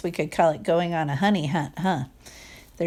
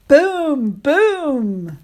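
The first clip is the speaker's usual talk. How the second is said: louder, higher booming stomps; 7 semitones higher, 5 dB louder than usual. Acted. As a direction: stomp it out big. Echoing log stomps.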